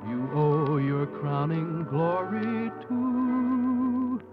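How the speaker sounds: ordinary talk, nothing marked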